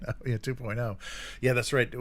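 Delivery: ordinary delivery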